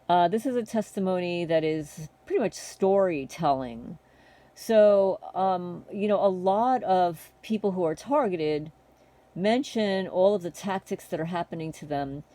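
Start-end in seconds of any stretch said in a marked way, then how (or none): none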